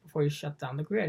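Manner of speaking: usual speaking voice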